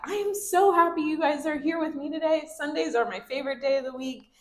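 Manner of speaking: high-pitched, delighted welcome; so glad to see them, lifted pitch; happy greeting to kids. Shown as a demonstration. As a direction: gush to a class of kids. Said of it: "So say that".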